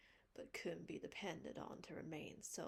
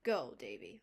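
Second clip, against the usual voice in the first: stern command; 7 semitones lower, 9 dB louder than usual. Acted. Marilla sends Davy off.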